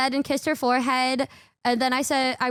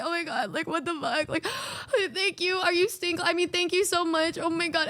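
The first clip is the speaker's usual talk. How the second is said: softer, higher choked-up thanks; holding back tears; 4 semitones higher, 4 dB quieter than usual. Retold or imitated. Thanking a musician for praise, softly choked up. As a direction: fight back mock tears as you gush. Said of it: "trying not to cry".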